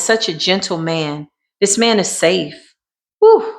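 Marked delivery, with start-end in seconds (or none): 3.2-3.5 a high, loud exhale of amazement; whew of awe. Real, not acted. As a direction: blow out a sharp high whew. Reader overwhelmed by the energy.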